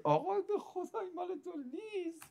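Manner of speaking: high, soft, crying entreaty; tearful plea. Acted. Devotee swearing to the Imam it is not for him, tearful plea. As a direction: plead in a thin, weeping high voice.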